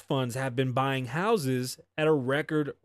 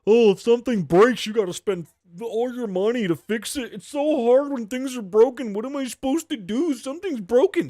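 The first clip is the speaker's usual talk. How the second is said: high, loud whiny mockery; hammed-up whining complainer; 10 semitones higher, 7 dB louder than usual. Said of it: "people feed the bullshit to you".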